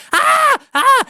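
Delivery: put-on high, piercing kid shrieks; short imitated screams of wild kids; children's screams. Imitating feral kids running round the hotel room, screaming. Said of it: "five little feral children".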